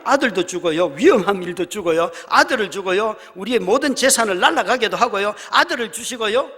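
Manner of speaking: loud, driving list; preaching at full force. Real, not acted. Preacher drives home God's gifts, loud. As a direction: hammer each item home. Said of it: "preaching listing, loud".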